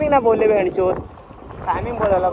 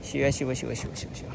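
fading to a whisper; 5 semitones lower, 11 dB quieter, faster than usual. Real, not acted. Fading a repeated word out.

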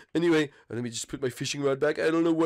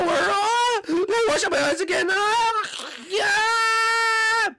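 crying Bart; 4 dB louder, much slower than usual. Acted. Bart sobbing about his eyes.

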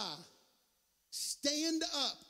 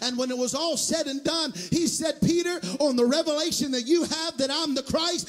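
loud sermon cadence climbing higher; full-force preaching; 6 semitones higher, 14 dB louder than usual. Real, not acted. Preacher urging the congregation not to dwell on weakness, full-force preaching. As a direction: build steadily into a shouted, driving rhythm.

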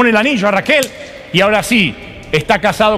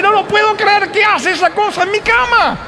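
much higher, squealing outrage; high, appalled wail of disbelief; horrified disbelief; 13 semitones higher than usual. Acted. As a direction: cry out like the bed holds a monster. Jacob's disbelief at Lea.

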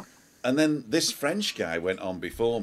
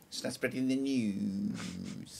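put-on slow sung lyric in a French accent; lightly French-accented crooning; 2 semitones higher, 4 dB quieter, much slower than usual. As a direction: croon it slowly like a French singer. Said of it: "with a French accent as well".

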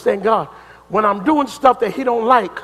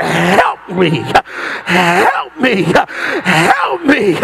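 driving, repeated cries for help to God; acted desperate prayer at full force; supplicating; 4 semitones higher, 5 dB louder than usual. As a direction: beg God hard in preaching rhythm, building as you go. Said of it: "start supplicating".

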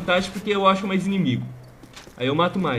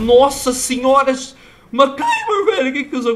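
loud, high cry with a laugh; shocked outburst; 9 semitones higher, 8 dB louder than usual. Real, not acted. Gamer blown up by a Claymore, shocked laughing cry. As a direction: blurt it out, stunned.

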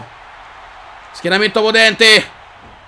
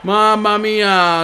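usual speaking voice

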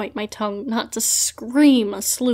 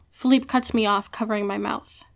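ordinary delivery

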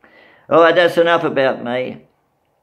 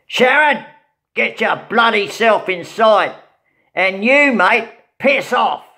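mock yell; 6 semitones higher than usual. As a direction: bark it like a cranky father at the door. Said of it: "her dad yelled".

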